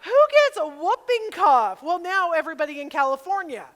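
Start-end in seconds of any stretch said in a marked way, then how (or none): 0.0-1.8 loud, high-pitched incredulous outburst; incrédula. Real, not acted. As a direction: exclaim it in comic disbelief. Comic disbelief at own diagnosis.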